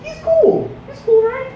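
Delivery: mock-hopeful, very high voice; high-pitched reassurance. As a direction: push it way up high, seeking agreement. Nervously reassuring himself about dealer.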